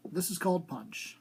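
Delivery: ordinary delivery, nothing marked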